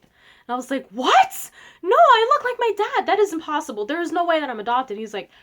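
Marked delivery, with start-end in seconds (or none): 0.9-5.0 high, loud protest easing toward the end; indignant disbelief. Retold or imitated. Girl denying her brother's adoption claim, indignant disbelief. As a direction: refuse to believe it, voice jumping up.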